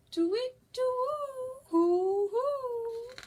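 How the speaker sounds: high, hooting bird calls; owl hoots. Pretend. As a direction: hoot goodnight like an owl. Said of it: "Now say good night".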